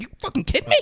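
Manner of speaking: high-pitched mock disbelief; put-on high voice, rising at the end; incredulous mom. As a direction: squeak it out in disbelief.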